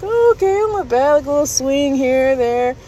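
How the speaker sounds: high, breezy mock approval; lightly unimpressed, high voice; casual approval. Recalls naive first impression of ride.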